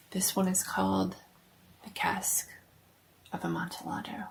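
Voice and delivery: hushed, half-whispered horror-show intro; spooky host whisper. Acted.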